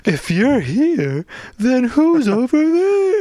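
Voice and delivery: very high, slow, squeaky. Acted. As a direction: squeak it out in falsetto. Spooky mock line.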